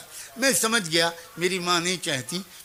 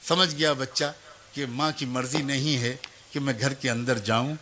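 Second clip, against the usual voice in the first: usual speaking voice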